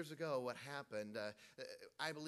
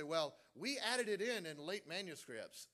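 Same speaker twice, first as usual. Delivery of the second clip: slow, raised-pitch mimicry; mock excuse; 5 semitones higher, 7 dB louder, slower than usual. Preacher imitating critics who say it was added, mocking. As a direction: draw out the opponents' excuse mockingly.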